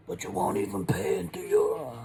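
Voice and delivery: the impression trails off into a whisper; whispered Godfather. Finishing the Brando impression for viewers in a whisper. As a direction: whisper the Don's line. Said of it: "Marlon Brando impression".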